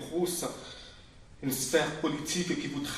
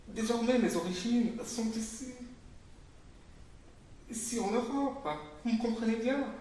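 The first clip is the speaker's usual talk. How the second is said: high, insistent proclamation, each "Blanche" stressed; fervent declaration; 7 semitones higher than usual. Acted. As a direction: hammer home the claim to whiteness.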